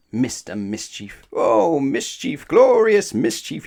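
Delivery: rising to a high, loud, slow gleeful cry. Acted. Mister Mischief delighting in his own pranks, high gleeful cry. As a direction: crow with delight over the mischief. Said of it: "he cried".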